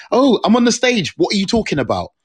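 high, loud put-on surprise; high-pitched mocking imitation; mock clueless newcomer. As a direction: blurt it like a clueless intruder. Mimics clueless people joining stage.

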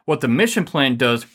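ordinary talk

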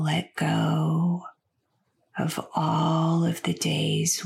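slow, soothing meditation voice with long pauses; unhurried, stretched words, easing the listener toward sleep; guided sleep meditation. Acted. Host guiding listeners with insomnia into sleep, slow guided meditation.

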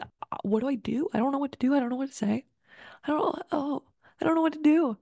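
hammed-up helpless fluster; mock panic. Flustered friend not knowing what to do.

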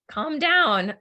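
imitating a parent's sharp calm down; parent saying calm down. Imitating a parent telling an upset kid, mock stern calm down. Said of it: "a parent saying".

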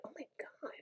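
an unvoiced shocked whisper; whispered gasp. Viewers whispering in shock at her bluntness. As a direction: breathe it like scandalised viewers.

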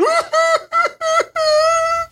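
high wailing cry sound; exaggerated mock crying wail; mock sob. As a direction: ham up a bawling sob. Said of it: "I cried".

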